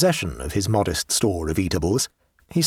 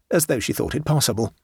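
ordinary delivery

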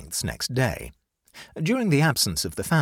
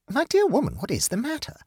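alarmed; 14 semitones higher than usual. A narrator's reading. Friend sees Amanda collapse.

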